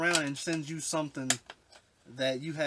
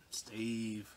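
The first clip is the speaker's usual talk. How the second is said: choked up; 3 semitones lower, 6 dB quieter than usual. Real, not acted. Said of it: "I'm getting choked up on this".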